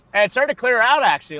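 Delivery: excited. Real, not acted. Excited at clearing storm.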